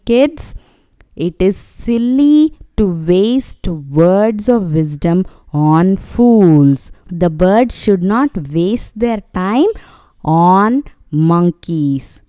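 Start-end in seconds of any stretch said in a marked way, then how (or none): 1.2-6.9 slow, weighty words of wisdom; stressing the moral. Shown as a demonstration. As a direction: lay the moral down slowly for the kids. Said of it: "what is the moral of the story".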